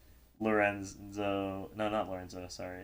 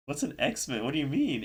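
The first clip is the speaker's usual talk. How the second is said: higher-pitched retold bafflement; baffled questions, pitched up and a bit louder; confused; 5 semitones higher, 3 dB louder than usual. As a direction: replay your puzzled questioning, voice lifted. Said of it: "I got really confused".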